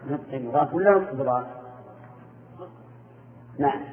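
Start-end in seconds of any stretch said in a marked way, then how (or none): none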